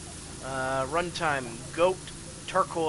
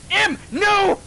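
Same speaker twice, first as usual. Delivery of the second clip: very high, loud shriek of protest; shrill, giggly mock panic; playful yell; 8 dB louder than usual. Real, not acted. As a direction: shriek at a friend to stop. Yelling at friend in game.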